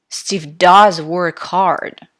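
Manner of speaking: slow sentence, hit "does" hard; stress on does. Shown as a demonstration. Teacher showing students emphasis, loud stress on "does". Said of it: "how I pronounce these sentences".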